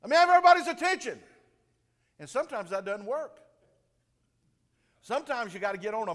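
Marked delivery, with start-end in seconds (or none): 0.0-1.2 high, loud call for attention. Retold or imitated. Pastor calling a noisy fellowship meal to order. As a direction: shout over a noisy room. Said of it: "And I'm going".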